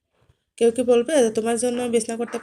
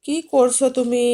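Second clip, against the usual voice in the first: slow loud call; 3 semitones higher, 8 dB louder, slower than usual. Real, not acted. Coaxing pet off the bed.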